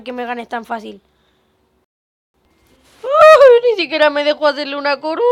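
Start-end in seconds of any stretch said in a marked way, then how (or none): 3.0-5.2 high loud fast disbelieving cry; shocked outburst. Real, not acted. Gamer stunned the rival denied him a crown. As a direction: yelp in shock then rattle it off fast. Opponent wins suddenly.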